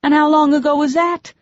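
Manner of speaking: high, loud upset rebuke; angry scolding. Acted. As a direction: tell him off, still shaking. Scolding him after his dive.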